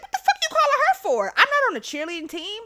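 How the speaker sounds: loud, high, fast indignant retort; snippy. Retold or imitated. Student snapping about the coach being called, snippy. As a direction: snap back high and loud, outraged. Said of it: "where I get, like, snippy".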